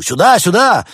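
high, loud cry for help; shouted summons; urgent call. A narrator's reading. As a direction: yell frantically to summon people over. Dealer drums on glass for help.

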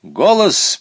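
high, loud command to the goat. A narrator's reading. Boy orders his goat to speak, commanding. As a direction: bark the order like a dog trainer. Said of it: "приказал Саня".

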